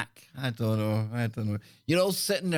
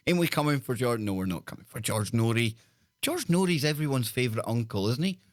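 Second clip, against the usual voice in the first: usual speaking voice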